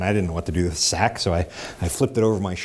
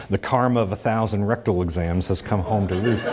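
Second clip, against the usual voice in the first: normal talking voice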